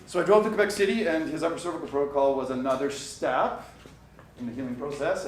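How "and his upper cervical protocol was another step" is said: ordinary talk, nothing marked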